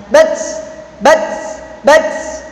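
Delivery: "Bats" checked on t with a hissed release; held sound. Shown as a demonstration. Teacher drilling tertahan on ta, held sound. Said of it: "Jadi terta".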